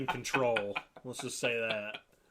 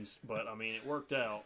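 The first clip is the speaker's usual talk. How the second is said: ordinary talk, nothing marked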